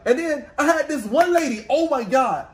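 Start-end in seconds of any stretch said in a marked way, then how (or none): none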